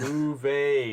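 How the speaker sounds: Uwe said as "OO-veh", W spoken as a V; German pronunciation. Shown as a demonstration. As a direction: correct German name, Uwe with a V sound. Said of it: "It's a V, not a W".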